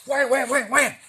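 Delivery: loud, high wham wham imitating strikes; striking sound. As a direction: hammer out each strike. Snake striking.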